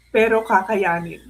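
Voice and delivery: halting, with long pauses to hold back tears; real tearful reading, low and broken by pauses. Real, not acted. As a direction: read it in separated pieces, choked up. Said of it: "Baka kasi nagkahiwa-hiwalay".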